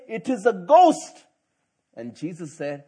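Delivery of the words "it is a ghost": loud, higher terrified cry. Retold or imitated. Disciples in the storm crying out loudly, slower. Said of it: "not even a whisper. They cried out".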